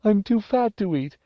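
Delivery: high, whining plea; frightened boy bargaining; begging. Acted. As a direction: plead your case in a panicky squeal. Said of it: "he wasn't simply begging".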